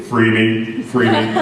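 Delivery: low, croaking frog voice; Franklin. Acted. Caged frog asking his rescuers for freedom, low croak. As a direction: croak the plea in a ribbit rhythm. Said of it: "a frog named Franklin".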